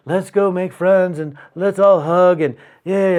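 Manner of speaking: slow, sing-song mocking enthusiasm; sarcastically gushy; mock gushy cheer. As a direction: draw it out like a parody of group-hug cheer. Mocking touchy-feely friend-making.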